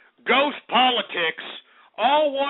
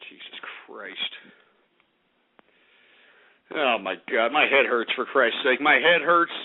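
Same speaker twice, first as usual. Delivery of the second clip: worn-out groaning. Real, not acted. Worn out, says head hurts.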